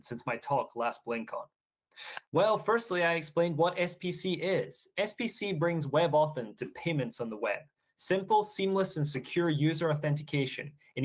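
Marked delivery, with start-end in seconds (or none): none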